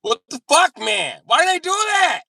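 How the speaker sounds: high loud indignant yelling; mock outraged yell. Friend retelling the shower burn, mock yelling high. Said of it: "he was like".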